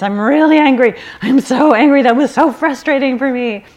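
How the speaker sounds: name the anger out loud, heated; narrating her anger aloud. Retold or imitated. Mother in the car, venting anger aloud to herself. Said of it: "start to kinda narrate my experience".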